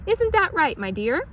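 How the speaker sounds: high loud call; shouting. A narrator's reading. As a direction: call out cheerfully to the pig. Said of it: "he shouted".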